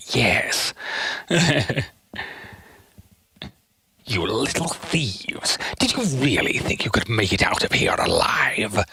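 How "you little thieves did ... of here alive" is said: quiet villain line. Pretend. Streamer keeping quiet for a sleeper, whispered threat. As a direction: menace them in a hush. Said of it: "I need to be kinda quiet".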